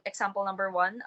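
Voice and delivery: ordinary talk, nothing marked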